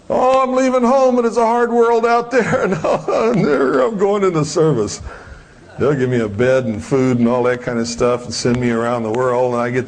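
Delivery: mock a starry-eyed kid signing up; naive young recruit.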